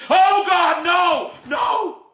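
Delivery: howled high and loud; a father's wail of despair; anguished scream. Acted. Gus screaming on seeing the truth. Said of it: "he screamed".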